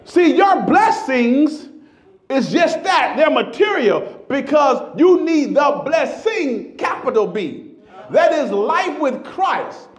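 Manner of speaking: loud, high, slowed preaching; full-force preaching. Acted. Pastor preaching to the congregation, loud and high. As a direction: preach it out to the rafters.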